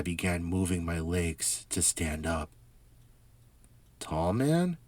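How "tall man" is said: neighbor voice. Acted. Neighbor questions the narrator.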